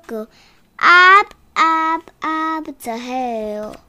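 sing-song storybook reading; reading to a toddler. Acted. Child reads picture book aloud.

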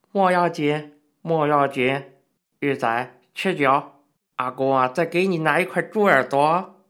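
loud hearty interjection; calling out. A narrator's reading. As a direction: boom in to lift the mood. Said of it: "插嘴叫道".

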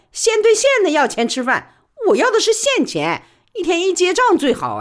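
brisk high-pitched working woman pleading her case; Li Baoli's voice. Acted. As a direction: press her case fast and eager.

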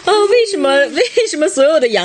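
high, fast, laughing words. Real, not acted. Mother laughing about goats eating everything. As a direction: laugh while you ask.

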